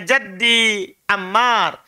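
slow and careful, each syllable of Jaddi Ammar clear; slow model reading. Shown as a demonstration. Teacher reads the title slowly for students to repeat. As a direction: read it out slowly for the class to copy. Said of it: "Lihat judulnya".

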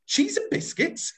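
high, indignant mock outrage. Shown as a demonstration. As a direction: sound scandalised at the price. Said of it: "can you get outrage into your voice for me?".

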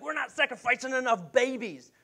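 high-pitched mock outcry; mock alarmed complaint. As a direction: imitate a worked-up crowd complaining, pitched high. Retold complaint when things were bad.

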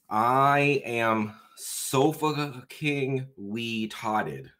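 very slow, drawn-out reading aloud; slow sounding-out. Pretend. Reading out a gag phrase for friends, very slow sounding-out. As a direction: stretch each syllable out as if sounding it out. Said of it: "I have to read it faster".

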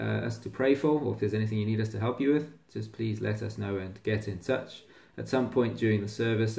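usual speaking voice

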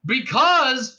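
high, loud cop's answer; shouting. A narrator's reading. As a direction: shout it as the start of an explanation. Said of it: "shouted the cop".

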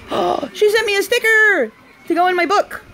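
high, loud thrilled exclamation; delighted gasp. Real, not acted. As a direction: gush over the surprise gift. Delighted by gifted sticker.

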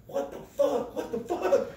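high, laughing what the fuck; giddy high-pitched laughing disbelief. Retold or imitated. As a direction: squeal it through laughter. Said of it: "And they're like".

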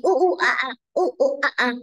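playful imitated monkey hoots; short bouncy monkey noises; monkey call. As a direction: hoot like a little monkey. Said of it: "and the monkey".

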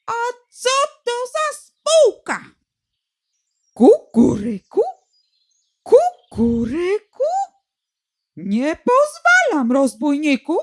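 mock-shriek like an offended cuckoo. A narrator's reading. Cuckoo shrieking at the rooster, mock outrage. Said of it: "wrzaśnie".

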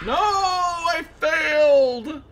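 high loud drawn-out cry of defeat; frustrated shout. Real, not acted. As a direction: wail it out slowly. Fails skill test, cries out.

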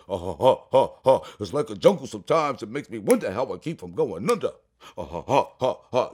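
old-school MC bass imitation; deep bassy voice. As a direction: rap it with low, chesty ha-ha laughs. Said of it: "with that real deep, bassy voice".

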